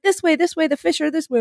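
high, imitated guide's call; calling directions. Boat guy directs a swimmer to the fish, calling out. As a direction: mimic someone calling a swimmer over.

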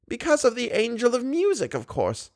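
put-on high, fond old lady voice; tender grandmotherly coaxing. A narrator's reading. Old lady calls Raoul near like a boy, high.

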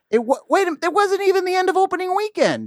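high-pitched incredulous protest; outraged disbelief. Real, not acted. Outraged at spoiled movie ending.

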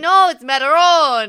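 loud, high mock correction; imitating indignant in-laws. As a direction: correct the word as the outraged relatives. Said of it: "they're like".

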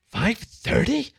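high, drawn-out incredulous question. A narrator's reading. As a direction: echo it in disbelief, rising at the end. Shocked committee repeats the hour.

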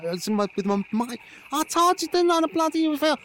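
excited triumph. Acted. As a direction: crow over the pebble trick. Lifts pebble with the mind.

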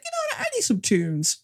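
high, eager mock voice of Brent deciding on music. Podcast host mocks Brent grabbing a cassette in the storm, high and eager. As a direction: squeak it out like a careless driver wanting tunes. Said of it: "it's like".

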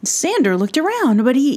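ordinary delivery, nothing marked

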